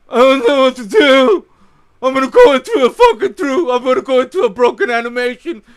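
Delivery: mock the player dithering loudly, in a silly voice; hammed-up confused footballer, speeding up at the end; Messi impression. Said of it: "That's my impression of Messi".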